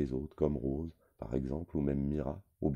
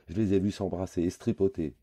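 bellowing; 5 semitones higher, 4 dB louder than usual. A narrator's reading. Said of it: "bugle Lenny".

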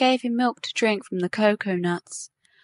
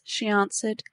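usual speaking voice

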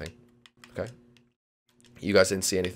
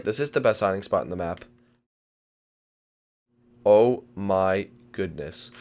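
usual speaking voice

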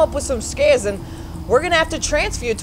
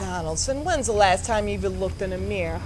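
low, soft pointed scolding; stern hush; 5 semitones lower, 7 dB quieter than usual. Acted. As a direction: drop it low and needle him. Boss scolds quietly.

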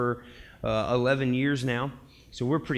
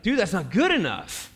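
higher, emphatic scolding with drawn-out words; worked-up, slow-hammered challenge; exasperated Elijah; 7 semitones higher, 4 dB louder, slower than usual. Acted. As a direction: demand to know where the passion went. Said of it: "And Elijah was like".

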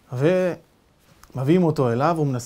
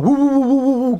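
loud, high animal hooting; bear-like roars; 8 semitones higher, 7 dB louder than usual. Retold or imitated. Giants shouting at the captive, beastly roars. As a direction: bellow like bears. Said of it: "והם צועקים עליו".

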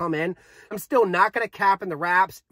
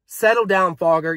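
normal talking voice

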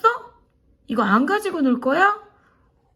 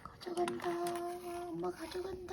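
hushed, stretched-out taunt; playful teasing; 6 semitones higher, 16 dB quieter than usual. Pretend. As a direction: tease the pet in a small high voice. Teasing pet about taking toy.

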